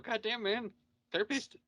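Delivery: ordinary delivery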